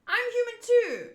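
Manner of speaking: high, loud protest; indignant shout. Acted. One frightened fighter argues with the other, acted indignant shout. Characters arguing in read manga.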